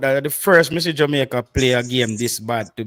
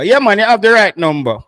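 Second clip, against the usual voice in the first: louder and higher, emphatic; louder, higher repeat; 6 semitones higher, 7 dB louder than usual. Shown as a demonstration.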